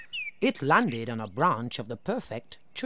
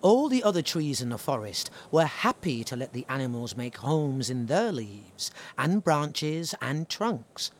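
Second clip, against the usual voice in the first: plain reading voice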